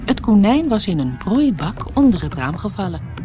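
usual speaking voice

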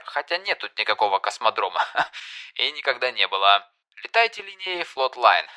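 mock airline announcement, barely holding laughter; pilot over the radio. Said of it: "сдавленный от смеха голос пилота по громкой связи".

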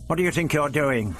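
indignant outburst at the guards; glaring challenge. Acted. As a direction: confront the captors.

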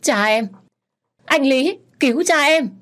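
high, loud desperate scream; screaming for help. A narrator's reading. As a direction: scream for someone to save her father. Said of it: "Tôi hét toáng lên".

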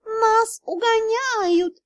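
high, very slow cat drawl; cat spirit announcing the hijack. Acted. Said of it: "произнёс дух золотого дракона".

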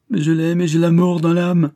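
loud, high cry of despair; acted anguish at full voice; anguished outcry. A narrator's reading. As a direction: burst out with tormented love. Son's anguished confession of love.

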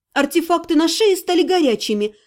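plain reading voice

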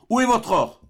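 a stern father demanding an answer; angry demand. A narrator's reading. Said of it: "malgré votre colère".

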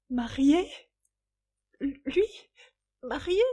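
high, halting questions; devastated disbelief. A narrator's reading. Young woman learning her lover is married, stunned. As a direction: let each word rise in shock. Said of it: "répétait la jeune femme anéantie".

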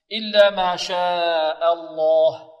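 loud, slow chant with a long held شاء; connected madd. Shown as a demonstration. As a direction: draw out the alif of شاء for four counts. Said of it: "هنا مد متصل، أربع حركات". (مد شاء أربع حركات)

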